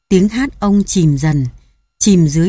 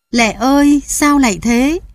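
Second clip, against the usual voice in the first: wailing cry; 6 semitones higher than usual. Acted. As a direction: cry out her name in grief. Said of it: "Ông kêu gào thảm thiết".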